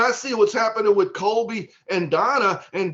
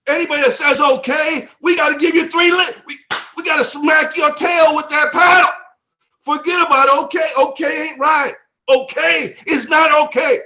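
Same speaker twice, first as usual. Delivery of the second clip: loud, high, fired-up exhortation; excited; 6 semitones higher, 4 dB louder than usual. Real, not acted. Leader warning the team off saying okay, loudly excited. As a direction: drive it home at high volume. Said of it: "you could tell [name]'s a little excited".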